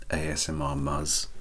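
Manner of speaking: ordinary talk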